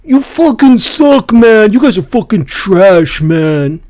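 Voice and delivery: high, loud whiny trash talk; whiny toxic gamer. Retold or imitated. Mocking a toxic player raging at teammates, high whine. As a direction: whine the insult like a sore loser. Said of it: "Then they're gonna hear this".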